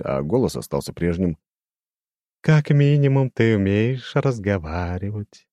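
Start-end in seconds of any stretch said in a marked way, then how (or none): 2.4-5.5 high, slow, ringing demon voice; eerie chiming demon, slow and lofty; crystal ringing voice. A narrator's reading. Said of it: "прозвенел демон своим хрустальным голосом". (высокий звенящий хрустальный голос демона)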